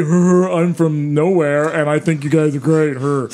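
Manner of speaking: low, slow dopey mumbler; stupid-listener voice. Pretend. Host imagines a silly fan writing in, dopey voice. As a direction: put on a dim-witted fan, slow and low. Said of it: "or something stupid".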